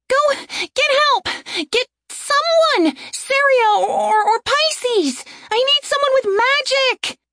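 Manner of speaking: high, frantic urgent voice; desperate pleading. Acted. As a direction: beg desperately for help. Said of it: "looked at Torin desperately".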